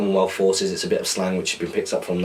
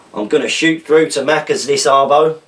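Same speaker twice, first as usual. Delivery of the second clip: put-on a loud, higher stab at an Australian accent; attempted Australian accent; 6 semitones higher, 7 dB louder than usual. Reading a viewer's slang to the audience, Australian accent attempt. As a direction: have a go at Australian, loud and a bit high. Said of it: "I'm not sure if I can do the accent".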